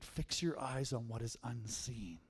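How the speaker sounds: slow hushed whisper; whispered verse. Shown as a demonstration. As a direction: let the verse fall into a whisper, slowly. Repeats verse, now whispered.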